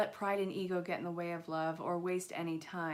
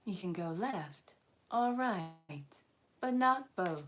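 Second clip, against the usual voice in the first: slow, even robotic phone menu voice; automated voice system; slower than usual. Pretend. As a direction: sound like a recorded voice menu. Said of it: "I know I sound like a automated voice system".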